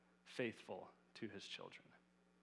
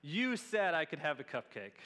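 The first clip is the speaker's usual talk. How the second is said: imitating his little daughter; 6 dB louder than usual. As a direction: mimic a kid holding dad to his word. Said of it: "That's how she would say it".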